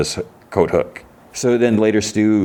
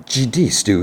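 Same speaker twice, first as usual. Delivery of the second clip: retold annoyance, pitched up; mildly mad; 6 semitones higher than usual. Dave snaps back at Stu, mildly mad. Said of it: "he kinda gets mad".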